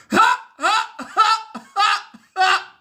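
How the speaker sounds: high, loud cackling laugh; real laugh. Real, not acted. After telling the hooker joke, laughing hard. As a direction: crack up at the punchline. Laughing at his own punchline.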